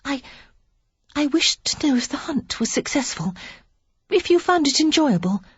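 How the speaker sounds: high, hesitant near-whisper; shy young lady whispering nervously; timid whisper. A narrator's reading. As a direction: barely dare to ask the question. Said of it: "Penelope said timidly".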